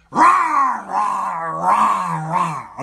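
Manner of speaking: loud imitated roaring back and forth; comic "rah" growls, fading at the end; mock snarling argument. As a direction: roar wordlessly like a squabbling couple. Imitates couple's daily fighting.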